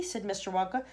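plain reading voice

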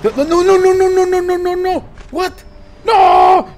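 high, rapid panicked protest. Real, not acted. Gamer watching his shark nearly die, panicked. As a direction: build from frantic repetition to a loud cry. Panics as the game goes wrong.

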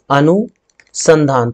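अनुसंधान drawn out slowly, syllable by syllable; teacher stretching अनुसंधान; slow spelling demo. Shown as a demonstration. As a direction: spell the word out slowly for the class. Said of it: "ये वाला जो अनुसंधान है".

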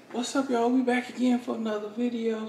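upbeat youtuber intro; vlog intro. Acted. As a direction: hype up the intro.

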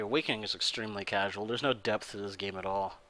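quick, softly spoken deadpan sarcasm; sarcastic. Real, not acted. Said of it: "Are you being sarcastic?".

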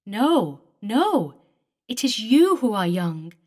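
ghostly denial. Acted. Eleanor answers David.

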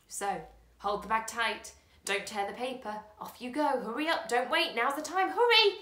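building from firm to a loud, high shout of hurry; old man sending James off, building to a shout; urging hurry. Acted.

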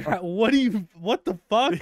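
can't believe what you just heard; retold shocked disbelief. Truck stop worker hearing about head-shaving lady, shocked outburst. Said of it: "I was like".

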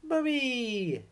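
high, loud joyful call; glad cry. Acted. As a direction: call out as she swoops home. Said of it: "they cried".

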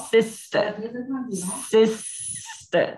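sister said slowly and clearly, both s sounds crisp, si-ster; careful pronunciation. Shown as a demonstration. Teacher drilling the class on sister, crisp s sounds. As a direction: model sister for the class, hissing each s. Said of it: "Chú ý từ này phát âm nha".

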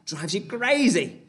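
high, playful exasperated wail; mock exasperation. Real, not acted. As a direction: wail at the twister in mock despair. Frustrated at twister slips.

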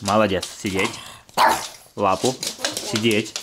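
firm loud command сидеть; firm dog commands. Real, not acted. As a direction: bark out the order сидеть. Команды собаке. (твёрдая команда собаке)

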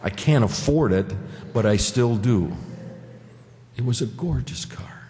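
ordinary talk, nothing marked

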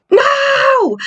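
high yelp of fright; frightened cry. Real, not acted. Climbing creepy stairs, she yelps in fright.

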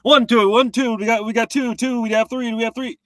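loud, rapid auctioneer patter; auctioneer imitation. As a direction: rattle the numbers off like a light auctioneer. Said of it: "He sounded like an auctioneer".

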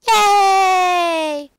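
high, loud, delighted yay; loud cheer. Real, not acted. Cheering a baby chicken.

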